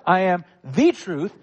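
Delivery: "the way" with "the" pressed and a rise; stress on THE. Shown as a demonstration. Pastor insisting to the congregation, "the" stressed. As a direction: hit "the" as the one and only.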